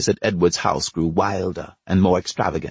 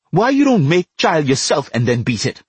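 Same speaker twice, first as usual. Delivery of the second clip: defiant yell, higher and louder; shouted taunt; 7 semitones higher, 4 dB louder than usual. Acted. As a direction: hurl it back at the man who beat you. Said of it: "he shouted to Edward".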